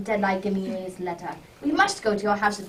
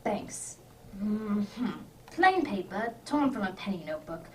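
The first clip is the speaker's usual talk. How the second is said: murmured; 7 dB quieter than usual. Acted. Holmes absorbed, studying the note.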